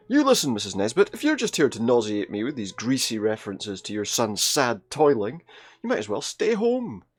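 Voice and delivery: mock scolding. Grandmother telling off Missus Nesbit over her pig talk, in a hammed-up scolding. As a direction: tell off the neighbour theatrically, voice jumping up. Gran's retold telling-off, read aloud.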